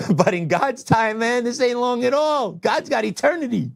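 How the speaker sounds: high, laughing through the words, slowing; amused at the thought of God's time. Real, not acted. Telling viewers a few years mean nothing to God, laughing.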